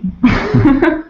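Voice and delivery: bright burst of laughter. Real, not acted. Host laughing at her own joke question.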